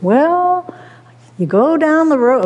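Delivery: a high, hammed-up attempt at a Maine farmer's accent; failed Maine accent. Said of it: "I can't do a Maine accent".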